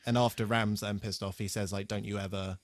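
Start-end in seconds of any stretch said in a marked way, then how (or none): none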